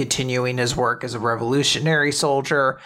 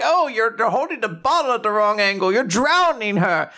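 put-on high, fretting old-lady voice; elderly helper correcting the new dad; fussy elderly helper; 10 semitones higher than usual. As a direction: fuss over the bottle like a worried granny. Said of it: "They're like".

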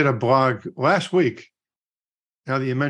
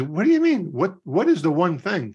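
high-pitched, imitated bewilderment; puzzled questioning; 6 semitones higher than usual. Young guy questions the old cowboy's answer, imitated puzzlement. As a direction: press for the meaning, mock baffled.

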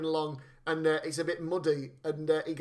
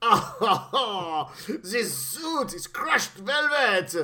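put-on French accent; 2 semitones higher, much slower than usual. Henchman lamenting.

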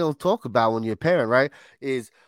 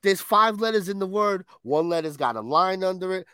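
usual speaking voice

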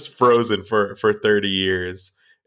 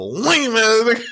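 high teasing yell; 12 semitones higher than usual. Retold or imitated. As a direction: yell the insult high, laughing. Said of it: "And you're like".

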